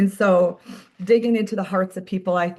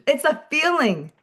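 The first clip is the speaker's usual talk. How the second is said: eager declaration; 6 semitones higher than usual. Retold or imitated. Officer answers with fervour.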